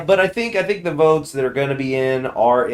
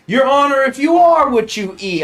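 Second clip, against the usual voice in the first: much higher and louder hammed-up lawyer; mock lawyer meme; 14 semitones higher, 9 dB louder than usual. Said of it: "but it's like".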